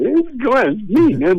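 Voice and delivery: high, pleading stoner voice at the door; mock a locked-out buddy begging to be let in, pitched well up; imitating Cheech. Retelling Cheech outside the locked door: high mock plea. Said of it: "every time he knocked".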